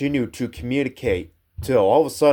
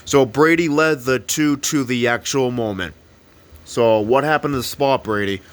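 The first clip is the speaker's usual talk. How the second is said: usual speaking voice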